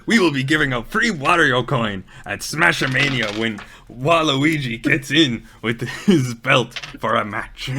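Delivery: a light Wario voice, announcing the giveaway; Wario announcement. Retold or imitated. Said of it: "Wario then goes".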